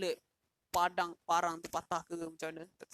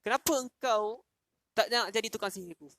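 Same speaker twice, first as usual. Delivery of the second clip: angry king; 5 semitones higher, 6 dB louder than usual. Retold or imitated. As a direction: bellow the question at the youth. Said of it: "Raja ni marah".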